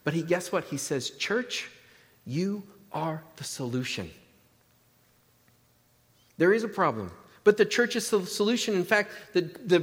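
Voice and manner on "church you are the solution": soft, deliberate, spaced-out words; solemn address. Acted. Jesus charges his church with a task, quiet and solemn. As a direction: speak softly to the church, pausing before the point.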